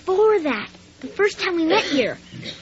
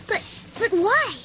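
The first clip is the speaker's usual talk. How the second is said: ordinary talk, nothing marked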